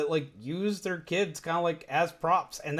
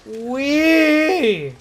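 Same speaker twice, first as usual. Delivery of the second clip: higher, louder playful whoop; gleeful wee; 9 semitones higher, 8 dB louder than usual. Real, not acted. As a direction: let it out like on a slide. Flying in game.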